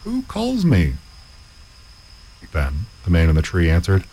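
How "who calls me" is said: startled high call of a student who sees no one; puzzled student calling out; cried out. Acted. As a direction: ask the empty road in a much higher voice. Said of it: "cried out".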